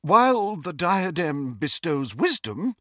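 high, controlled formality; composing herself. A narrator's reading. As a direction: speak with effortful composure. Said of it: "effort to pull herself together".

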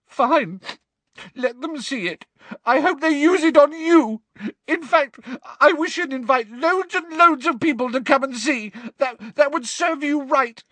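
high, loud, tearful spite; Hermione's high, sobbing outburst. A narrator's reading. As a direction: spit it out through sobs. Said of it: "now sobbing into her hands again".

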